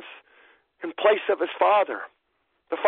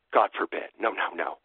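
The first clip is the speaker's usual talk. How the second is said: hushed refusal; 14 semitones lower, 5 dB quieter than usual. Retold or imitated. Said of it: "Most emphatically".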